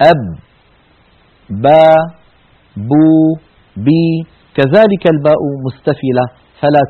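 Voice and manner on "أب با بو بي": أب با بو بي each crisp, with no puff of air; voiced ba drill. Shown as a demonstration. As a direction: tap out أب با بو بي as separate syllables. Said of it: "ينحبس النفس عندها".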